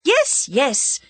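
exasperated yes; impatient. A narrator's reading. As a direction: snap out agreement impatiently. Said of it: "said the other impatiently".

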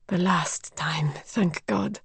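slow, hushed whisper; whispered thank God; whispered aside. A narrator's reading. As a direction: breathe it out in relief. Relieved murmur to herself.

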